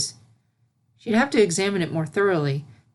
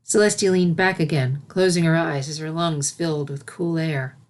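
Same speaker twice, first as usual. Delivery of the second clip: usual speaking voice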